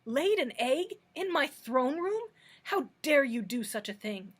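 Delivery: loud furious outburst; fury. Acted. The king furious that an egg was laid in his throne room. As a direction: blast her with outrage. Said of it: "asked the king in a voice of fury".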